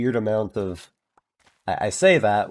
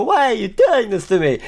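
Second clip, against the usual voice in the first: higher, louder mock despair; hammed-up pleading; 11 semitones higher, 4 dB louder than usual. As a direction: beg like a melodrama victim. Pleading with staff.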